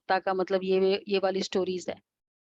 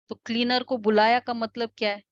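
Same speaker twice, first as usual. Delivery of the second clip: normal talking voice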